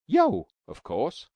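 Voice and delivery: loud, slow, drawn-out "Yo"; eager answer. Acted. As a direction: stretch it out proudly. Taffy answering proudly.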